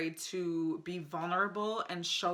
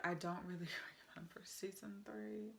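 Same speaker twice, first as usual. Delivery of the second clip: whisper; 14 dB quieter than usual. Real, not acted. Aside forgetting season three.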